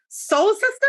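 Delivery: high, drawn-out disbelief at Soul Sister; incredulous. Real, not acted. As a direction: say Soul Sister rising, slow, appalled. Mocking the phrase.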